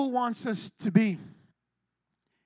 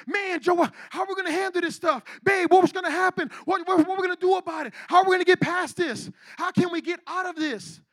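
frantic, acted stream of questions; panicky; 3 semitones higher than usual. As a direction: act all panicky, pleading for answers. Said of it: "you start acting all panicky".

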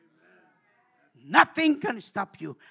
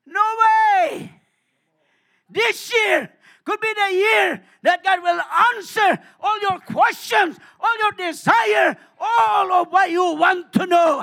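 fiery preaching; 8 semitones higher, 11 dB louder, slower than usual. Acted. Preaching at full force.

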